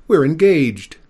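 high, loud wail. A narrator's reading. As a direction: wail it in despair. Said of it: "she wailed".

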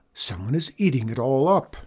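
ordinary delivery, nothing marked

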